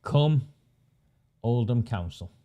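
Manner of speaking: normal talking voice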